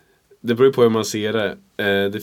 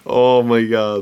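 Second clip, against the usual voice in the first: exasperated drawl; much slower than usual. Real, not acted. Groaning at the joke.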